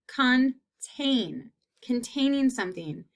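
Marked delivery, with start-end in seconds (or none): 0.1-1.5 contain in two separate beats; split into syllables. Shown as a demonstration. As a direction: pause between con and tain in contain. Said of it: "is in the second half".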